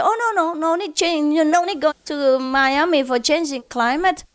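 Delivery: high, quick no no no; mock husband protest. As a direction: brush off the wife's idea. Husband refusing wife's trip.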